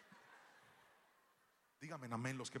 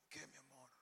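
sleepy murmur; 11 dB quieter than usual. Retold or imitated. Sleepy dad answering daughter.

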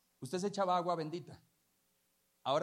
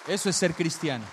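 firm repeat; 10 dB louder than usual. Real, not acted. Preacher repeats the lesson to congregation, firm loud statement. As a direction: land it firmly, voice still loud. Repeats the point, lower pitched.

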